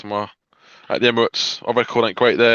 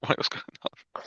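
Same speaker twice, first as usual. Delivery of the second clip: whisper; 10 dB quieter than usual. Retold or imitated. Quoting own confused thought.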